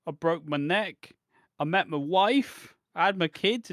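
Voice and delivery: mock tragic list. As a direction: recite each milestone like a tragedy. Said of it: "Tommy Dreamer's like".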